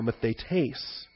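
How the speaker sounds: "mathetes" quietly, accent on the last syllable, -tes; final-syllable stress. Shown as a demonstration. Pastor repeating the Greek word, "mathetes" stressed on the final syllable. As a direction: land on the end of "mathetes": ma-the-TES. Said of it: "if you accent the final syllable".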